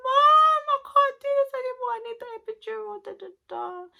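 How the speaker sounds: high, loud wail down the phone to mum; hammed-up pleading, shrill and rushing, tailing into filler; retold desperate crying. Woman in labour begs mum on the phone for pain relief, mock wailing. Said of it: "I was crying".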